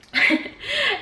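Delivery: sudden high-pitched giggle; high squealing laugh. Real, not acted. Laugh after he cooked it.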